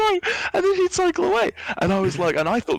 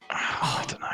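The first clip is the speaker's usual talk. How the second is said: whispered; 6 dB quieter than usual. Real, not acted. Puzzled speaker sighing and whispering the answer. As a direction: sigh then whispered words. Unvoiced sigh.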